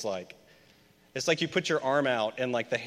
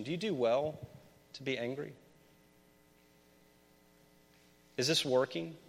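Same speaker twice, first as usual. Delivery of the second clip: gentle questioning God; 8 dB quieter than usual. Acted. Imagining God asking you.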